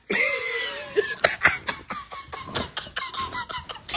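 long breathless wheeze of laughter; high wheezing laugh. Real, not acted. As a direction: fall apart laughing. Laughing hard at own joke.

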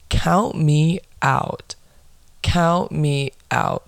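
slow, word by word; emphatic refusal. Real, not acted. Woman fed up with how men flirt, emphatic refusal. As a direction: draw out each word to rule it out.

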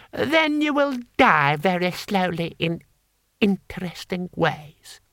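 cold noble toying with a captive; menacing lord. Acted. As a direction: savour each word of the threat. Lord Hong menacing Cohen.

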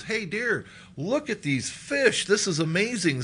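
high, amazed call to his wife; acted excitement. Excitedly shows wife the fish.

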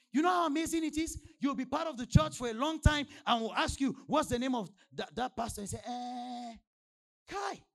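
loud, high-pitched sermon delivery; full-force preaching. Real, not acted.

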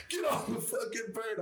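high hammed-up yell of a disgusted sergeant; mock shouting sergeant. Team sergeant ordering the filthy narrator off, mock high shout. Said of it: "he's like".

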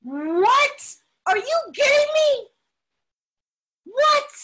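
high-pitched, incredulous outcry; hammed-up outrage, voice leaping up; overdramatic disbelief. Speaker reacting to the big book, in melodramatic disbelief. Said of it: "us Latinos are very dramatic".

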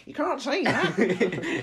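high, slow, drawn-out complaint; mock outraged commenter. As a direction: whine it like an offended Karen. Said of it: "where they're like".

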